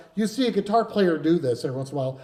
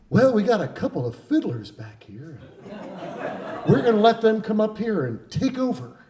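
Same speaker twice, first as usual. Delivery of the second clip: lightly voiced folk singer on stage; 5 semitones higher than usual. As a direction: imitate a folk singer announcing to the crowd. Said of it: "we hear this voice, [name] goes".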